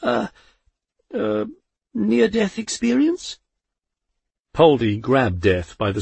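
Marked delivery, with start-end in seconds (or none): 0.0-3.4 embarrassed. A narrator's reading. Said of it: "embarrassed cough".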